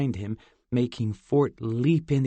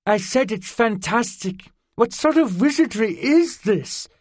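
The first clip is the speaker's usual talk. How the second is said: much higher, louder, amazed fairy; delighted fairy girl; 10 semitones higher, 6 dB louder than usual. A narrator's reading. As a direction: wonder at the taste of pizza. Zinnia delighted by pizza.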